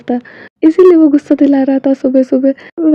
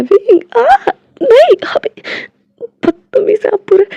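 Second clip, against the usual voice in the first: squealing protest; 9 semitones higher than usual. Acted. Protesting while being licked.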